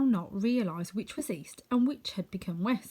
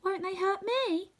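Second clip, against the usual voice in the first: much higher, slow worried question; a girl fearing the monkeys; anxious; 12 semitones higher, slower than usual. Acted. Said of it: "requested the woman anxiously".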